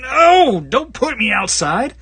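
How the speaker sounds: high, slow pleading cat voice; whiny cat. Pretend. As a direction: beg like a cat refusing to go out. Cat pushed out the door.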